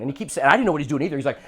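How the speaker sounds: ordinary delivery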